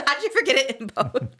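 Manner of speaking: laughing mock question. Mocking quote while laughing.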